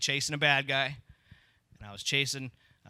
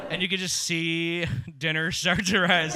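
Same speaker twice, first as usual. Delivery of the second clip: usual speaking voice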